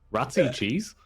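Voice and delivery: lightly voiced puzzled co-caster, rising; playful mock bafflement; mock confused question. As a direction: imitate a baffled listener. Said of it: "First time someone goes".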